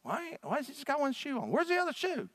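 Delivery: high, puzzled mock wondering; the congregation staring at a missing shoe, high and puzzled; puzzled congregation member. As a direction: play a nosy churchgoer wondering aloud. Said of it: "I know where all y'all gonna be looking".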